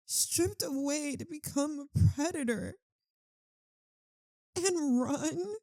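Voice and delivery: ordinary talk, nothing marked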